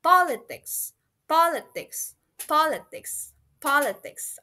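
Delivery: "Politics" starting high on POL, then falling; stress on first syllable. Shown as a demonstration. Teacher drills learners, "Politics" stressed on first syllable. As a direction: hit POL in "Politics" and drop away. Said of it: "emphasis in the first word".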